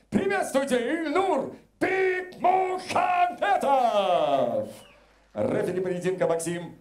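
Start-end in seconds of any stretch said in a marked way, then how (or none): 0.1-4.7 higher, drawn-out fighter introduction; ringmaster. Real, not acted. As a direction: build to the name like a boxing MC. Ring announcer introduces fighter. (протяжно и торжественно, как ринг-анонсер)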